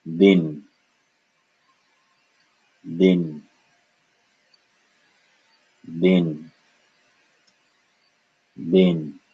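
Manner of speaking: Bin said as din, airless d; combined syllable. Shown as a demonstration. Teacher drilling students, Bin as a single din syllable. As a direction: say Bin as one short din, spaced out. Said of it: "we have to combine it".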